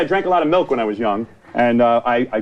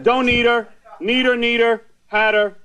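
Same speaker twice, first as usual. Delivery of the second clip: higher, slow list-like call-out; raised-pitch deliberate roll call; sorting aloud; 4 semitones higher, slower than usual. Real, not acted. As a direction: tick them off one by one, pitched up. Calls out categories from his chart.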